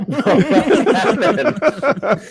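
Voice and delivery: a long, high burst of real laughter. Real, not acted. Comic laughs at the end of his elevator fight story. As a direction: crack up at the memory.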